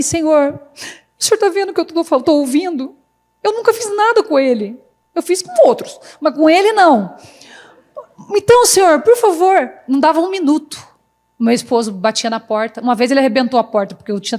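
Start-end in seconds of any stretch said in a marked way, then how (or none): 0.0-9.7 high, pleading mock-tearful prayer; choro imitado. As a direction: re-enact tearfully begging God to vouch for you. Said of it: "eu lá chorando assim". (súplica chorosa encenada, em voz aguda)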